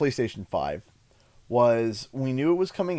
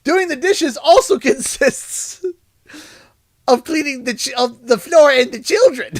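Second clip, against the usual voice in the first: laughing; 12 semitones higher, 5 dB louder than usual. Real, not acted. Laughing through the story.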